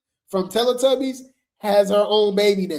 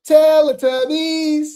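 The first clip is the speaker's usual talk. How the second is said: excited disbelief; 8 semitones higher, 7 dB louder, slower than usual. Real, not acted. Shocked by the Teletubbies memory.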